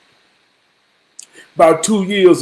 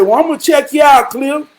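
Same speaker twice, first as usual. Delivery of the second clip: quick upbeat promise; 7 semitones higher than usual. Retold or imitated. Telling Cliff.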